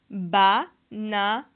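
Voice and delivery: "Banane" split slowly into ba-na-ne; découpage en syllabes. Shown as a demonstration. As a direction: sound out "Banane" syllable by syllable, pausing between. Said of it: "combien de syllabes". (« Banane » syllabe par syllabe, lentement)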